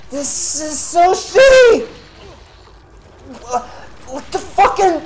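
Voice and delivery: very high, loud screech of frustration; real raging shriek; furious yelling. Real, not acted. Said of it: "I'm sorry for being so loud".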